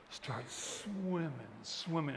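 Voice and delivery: slow, soft, gliding words; drawn-out narration. Retold or imitated. Speaker picturing a lone swimmer pushing on, drawn out. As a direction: stretch "swimming" out slowly, like long strokes. Acting out a steady swim.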